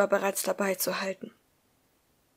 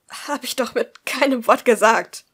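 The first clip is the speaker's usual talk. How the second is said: louder, slow burst of protest; blurted out; 3 semitones higher, 10 dB louder than usual. Acted. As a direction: force the words out, hurt. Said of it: "stieß sie hervor". (Liz stößt es gegenüber ihrer Mutter hervor)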